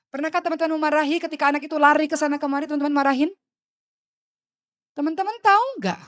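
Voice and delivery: preacher challenging mothers, loud and high; impassioned rebuke. Real, not acted. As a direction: confront the listeners. Rebuking the congregation.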